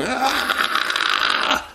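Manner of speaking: sudden very high shrieking yelp; high strangled comic scream; Bobcat's scream. Retold or imitated. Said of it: "he screamed that scream".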